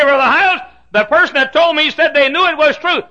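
high, shouted protest; loud objection. Acted. Church member objecting to Brother Hiles, loud objection. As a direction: argue back at full volume.

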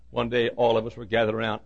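ordinary talk, nothing marked

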